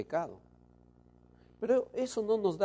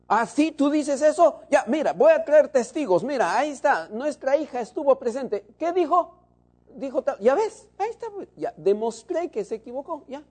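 higher, louder mock-triumphant spouse in a quarrel; arguing spouse; 8 semitones higher, 6 dB louder than usual. Preacher acting a husband winning a quarrel, mock triumphant. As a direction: play the smug spouse winning the fight. Acted marital argument.

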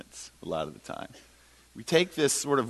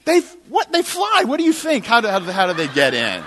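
high, loud exasperated retort; getting madder; 10 semitones higher, 10 dB louder than usual. Retold or imitated. Tenant explaining entry to landlord, getting madder. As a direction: shout back as at a stupid question, then ease slightly. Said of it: "So I'm getting madder and madder".

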